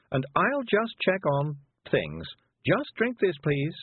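high-pitched, coaxing fuss; calming fusser. A narrator's reading. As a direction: coax along in a lifted, busy voice. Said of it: "because it's a calming word".

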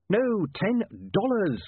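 "ten dollars" high and very drawn out; emphatic explaining. A narrator's reading. Newspaperman explaining to bewildered dwarfs, stressing "ten dollars". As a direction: spell out "ten dollars" slowly, word by word.

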